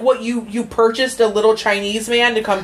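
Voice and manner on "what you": ordinary talk, nothing marked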